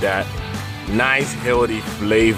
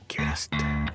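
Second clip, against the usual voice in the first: whispering; 5 semitones lower, 8 dB quieter than usual. Acted. Playful whispered aside while stirring.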